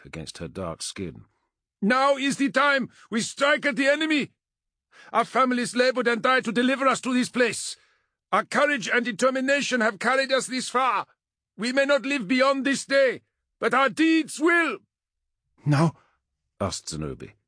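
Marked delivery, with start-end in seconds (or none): 1.8-14.8 high, loud battle speech; rally soldiers who may die today; passionate shout. A narrator's reading. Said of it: "Egu shouted, not simply to be heard, but filled with passion". 15.7-15.9 higher, louder emotional question; quavering with emotion. A narrator's reading. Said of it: "her voice quavering with emotion".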